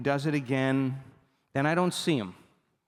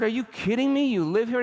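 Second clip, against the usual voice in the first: high, louder disbelief at the coincidence; amazed disbelief; 9 semitones higher, 4 dB louder than usual. Retold or imitated. To the former student on the hotel stairs, high amazed disbelief. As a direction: gasp it out like you can't believe it. Speaker retells his own surprise.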